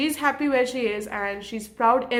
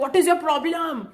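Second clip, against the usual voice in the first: sharp annoyed snap back, pitched up; angry retort; 4 semitones higher, 4 dB louder than usual. Retold or imitated. Teen retorting to a classmate, annoyed. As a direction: fire it back at someone who teased her. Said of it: "I used to be like".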